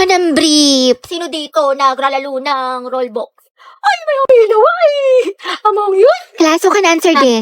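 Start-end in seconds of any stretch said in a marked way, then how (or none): 3.8-6.2 much higher, swooping voice; high teasing squeal. Acted. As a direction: squeal it out, pitch leaping up.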